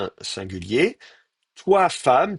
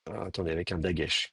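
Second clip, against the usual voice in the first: normal talking voice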